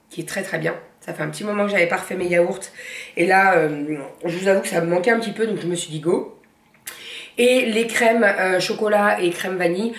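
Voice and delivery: ordinary delivery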